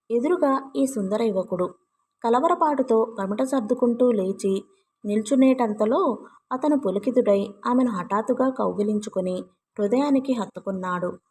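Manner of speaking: ordinary delivery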